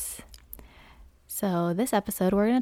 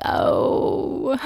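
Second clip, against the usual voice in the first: breathy sheepish oh; a small whispered realization; 5 semitones higher, 4 dB louder than usual. Retold or imitated. Caught out in class, whispered oh. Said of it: "And I was like".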